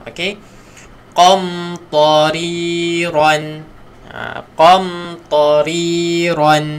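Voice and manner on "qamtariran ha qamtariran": qa from the back of the tongue; teacher modelling a heavy qa. Shown as a demonstration. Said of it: "Kena ra".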